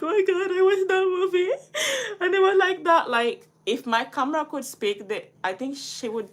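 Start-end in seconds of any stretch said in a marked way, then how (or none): 0.0-1.5 high, loud, half-laughing half-crying wail; mock-sob over a sad movie ending. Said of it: "and I'm like". 1.6-2.1 high teary laugh; a wet laugh through tears; crying laugh. Retold or imitated. Crying over a movie ending.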